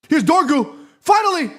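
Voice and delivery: high hopeful call; excited. Real, not acted. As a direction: build up to a cheer. Attack building in live match.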